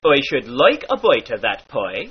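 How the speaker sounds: "pie" said "poi"; long I as oi. Shown as a demonstration. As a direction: repeat with oi in "bite" and "pie". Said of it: "becomes oi".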